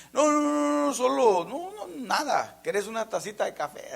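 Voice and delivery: high, loud flustered denial; nervous Miriam. Acted. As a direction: play the caught sister, overly sweet. Voicing guilty Miriam.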